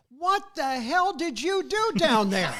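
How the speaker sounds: hammed-up mother blaming her son; accusing mom. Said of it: "she says to me".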